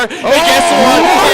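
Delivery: slow loud teasing build-up; drawn-out tease. Real, not acted. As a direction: stretch it out to tease the crowd. Caster builds up to a fight.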